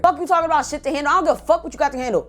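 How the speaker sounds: high-pitched, fast indignant tirade; retold angry yelling. Retelling cussing out Ricardo over his excuse, high angry rant. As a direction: tear into him, pitch jumping up. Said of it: "I started yelling at him so bad".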